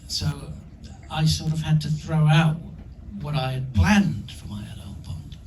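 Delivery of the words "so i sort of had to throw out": normal talking voice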